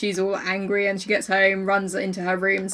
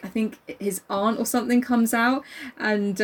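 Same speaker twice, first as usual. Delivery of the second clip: usual speaking voice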